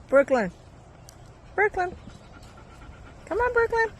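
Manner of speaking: rising high calls to the dog; calling out. Real, not acted.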